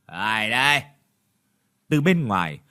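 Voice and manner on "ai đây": sleepy. Acted. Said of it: "ngái ngủ".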